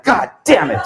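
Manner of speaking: hammed-up exasperated outburst; mock frustration. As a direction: curse the rival's perfect answer, half laughing. Frustrated at rival's answer.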